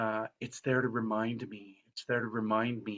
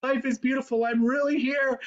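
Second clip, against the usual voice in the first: much higher, louder, ecstatic cry; elated; 11 semitones higher, 4 dB louder than usual. Retold or imitated. George Bailey tells the policeman he doesn't care, ecstatic. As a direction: shout his joy at the policeman. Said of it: "he says to the policeman".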